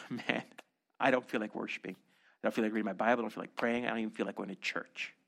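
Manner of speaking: sighing, low and quiet admission; weary reluctance. Acted. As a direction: confess it heavily, with no energy. Voicing a reluctant, honest believer.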